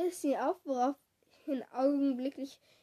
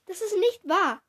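keuchend; 5 semitones higher, 7 dB louder, slower than usual. A narrator's reading. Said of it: "keuchte ich".

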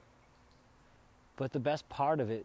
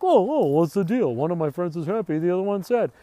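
higher, louder, puzzled Jerry voice; imitating Jerry; 6 semitones higher, 9 dB louder than usual. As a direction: do Jerry baffled by his friends. Jerry voiced in retold episode.

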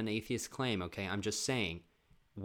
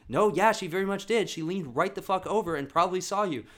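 loud insisting; 7 semitones higher, 6 dB louder than usual. Retold or imitated. Kids insisting.

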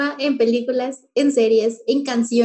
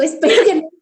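frase que se deshace en risa; riendo; 5 semitones higher, 3 dB louder, much faster than usual. Real, not acted. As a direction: suelta la risa al final de la frase. Ríe tras la broma. (termina la frase riéndose de verdad)

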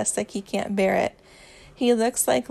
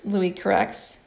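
ordinary delivery

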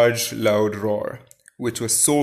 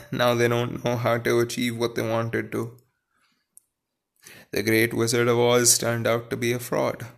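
ordinary delivery

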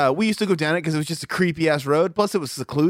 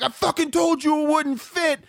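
higher-pitched, exasperated retold yell; retold angry yell; 10 semitones higher than usual. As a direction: replay the fury at your friend, pitched up high. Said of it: "I was fucking yelling".

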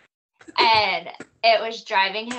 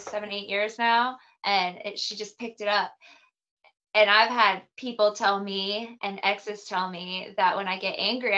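ordinary delivery

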